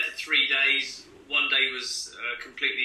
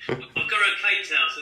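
higher-pitched, put-on local English accent; newly acquired English accent; 6 semitones higher than usual. Child coming home to mum with a changed accent, newly English. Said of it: "my accent completely changed".

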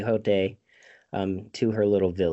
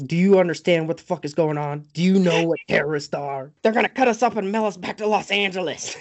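imitating a panicked rant, raised pitch; hammed-up frantic rush of words; mock freak-out; 7 semitones higher, faster than usual. Podcaster acts out Toby losing it, mock panic. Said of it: "freaking out".

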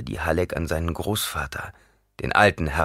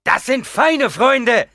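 slow, much higher, louder bitter yell; angry shout; 7 dB louder, much slower than usual. A narrator's reading. A soldier yells angrily back at Gurney. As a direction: shout back sarcastically in anger. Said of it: "schrie jemand wütend zurück".

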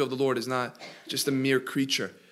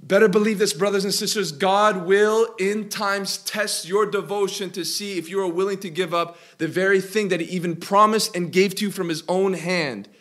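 louder, higher sermon voice building in fervor; full preaching; 4 semitones higher, 5 dB louder than usual. Acted. Pastor pressing the point to his church, fervent preaching. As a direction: preach it out to the congregation. Exhorting congregation.

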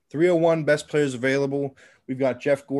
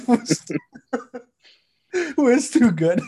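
after laughing hard; 6 semitones higher, slower than usual. Real, not acted. Laughing retake.

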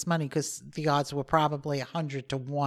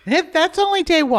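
excited correction; 11 semitones higher, 7 dB louder than usual. Real, not acted.

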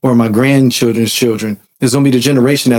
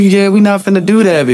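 ordinary delivery

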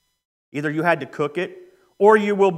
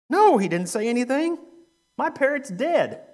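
higher-pitched indignant outburst; acted exasperation; 6 semitones higher than usual. Widow answering the shop owner about her parrot, exasperated. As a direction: cry out the complaint, mock outraged. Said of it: "she is hot".

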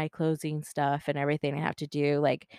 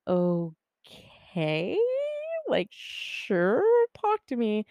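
drawn-out, rising "Okay"; wary agreement; 5 semitones higher, much slower than usual. Retold or imitated. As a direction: imitate a friend hesitantly agreeing. Friend warily agrees to listen.

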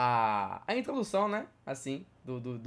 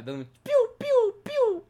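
high pew pew blaster noises; laser pew sounds; 5 dB louder, slower than usual. Retold or imitated. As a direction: mimic spaceship laser shots.

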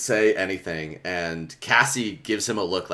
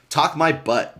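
sharp, louder retort; snapping; 6 semitones higher, 4 dB louder, slower than usual. A narrator's reading. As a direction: snap it back, higher and louder. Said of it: "Jake snapped".

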